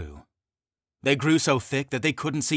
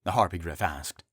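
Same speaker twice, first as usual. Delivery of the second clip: ordinary delivery, nothing marked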